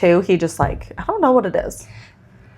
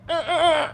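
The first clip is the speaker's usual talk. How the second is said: straining grunts; 7 semitones higher, 4 dB quieter than usual. Retold or imitated. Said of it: "and he went".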